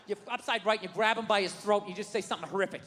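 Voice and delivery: usual speaking voice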